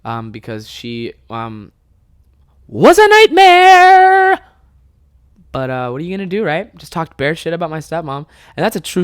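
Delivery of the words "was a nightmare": very high, loud, drawn-out yell; comic scream. Acted. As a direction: scream it out, stretching every word. Sudden yelled verdict on stepmom.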